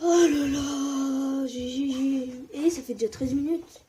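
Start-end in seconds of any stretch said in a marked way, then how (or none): 0.1-1.5 loud, slow, drawn-out oh là là; drawn-out cry. Real, not acted. Gamer exhausted after digging, long loud wail. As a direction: stretch it out like a groaning wail.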